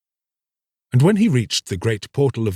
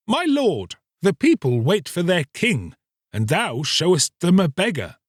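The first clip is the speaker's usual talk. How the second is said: higher, lightly voiced scornful noble; mocking noble; 8 semitones higher than usual. A narrator's reading. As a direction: tease him about his rags. Nobles mock the king.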